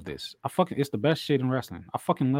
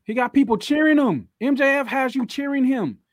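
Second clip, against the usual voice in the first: excited rant; 8 semitones higher than usual. Real, not acted. Excited rant, high pitch.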